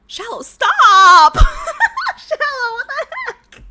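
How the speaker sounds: loud laughing stop, then high squeal; giggling shriek; laughing protest. Real, not acted. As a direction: laugh it off, embarrassed. Laughing at a friend's message.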